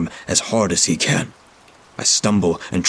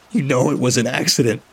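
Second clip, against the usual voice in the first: hoarse cracking; 6 semitones higher than usual. A narrator's reading. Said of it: "his voice cracking and hoarse".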